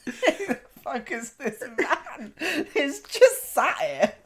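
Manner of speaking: slow, laughing mock indignation; laughing mock outrage. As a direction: play their annoyance while laughing. Imitating annoyed caller, laughing.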